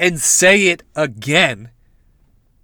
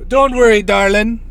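loud, hammed-up psychic accent; Miss Cleo; 4 semitones higher, 3 dB louder than usual. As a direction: put on an awful TV psychic accent. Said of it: "I wanna do a Miss Cleo so bad".

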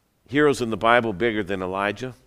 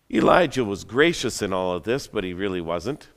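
ordinary delivery

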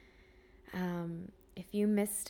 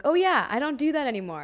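sudden realisation; 7 semitones higher, 8 dB louder, faster than usual. Retold or imitated. Quoting her own sudden realisation.

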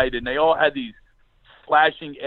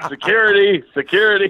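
calling for security; 5 semitones higher, 6 dB louder than usual. Acted.

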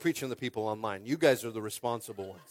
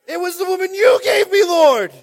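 high, loud mock wail; hammed-up whining excuse; 17 dB louder than usual. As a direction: shout the excuse like a tattling child. Adam blames the woman, mocked.

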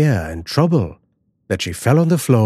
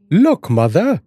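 husband's voice; 8 semitones higher than usual. Acted. Husband calls to wife.

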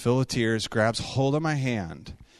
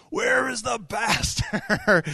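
slow, much higher mock shout of an angry man; 9 semitones higher, much slower than usual. Angry man in the lobby demands the pastor, slow high shout.

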